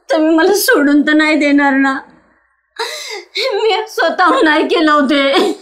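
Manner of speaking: sobbing plea, voice breaking; crying. Acted. Wife pleading with husband after miscarriage, sobbing. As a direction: weep as you insist it was not your fault. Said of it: "नको रडू सुरेखा".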